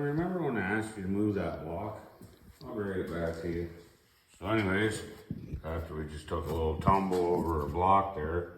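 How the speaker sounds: ordinary talk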